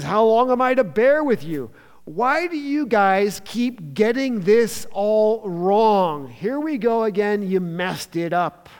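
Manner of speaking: high, loud exasperated scolding; sort of angry. Acted. Jesus scolding disciples who keep failing, frustrated. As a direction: scold them, frustration dragging out the question. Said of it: "He's sort of angry. He's like".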